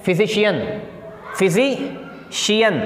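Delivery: "Physician" very slowly, split as fizi, pause, shiyan; broken into parts. Shown as a demonstration. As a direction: split "physician" in two for learners. Teacher breaks word into parts.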